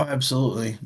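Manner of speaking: ordinary talk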